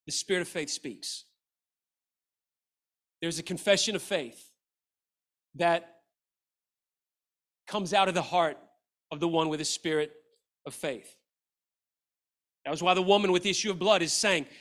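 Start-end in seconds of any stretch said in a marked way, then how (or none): none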